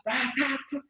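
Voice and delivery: high-pitched pan-banging noise; imitated banging. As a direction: act out the clatter, tá-tá-tá. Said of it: "ele bate". (imitando batidas de panela)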